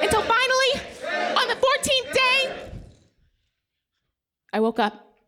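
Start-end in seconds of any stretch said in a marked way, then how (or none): 0.0-2.7 stretched, lifted storyteller build-up; dramatic build. Acted. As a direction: build the suspense up high.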